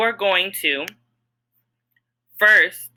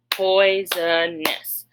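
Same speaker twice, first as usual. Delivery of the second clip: very slow, each syllable apart; syllable counting; much slower than usual. Shown as a demonstration. Teacher counting syllables with students, very slow. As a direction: sound out every syllable slowly. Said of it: "Let's count how many syllables we have".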